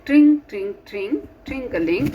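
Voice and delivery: loud, high ringing bell sound; high, loud tring of a bicycle bell. Acted. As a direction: ring it out like a bike bell.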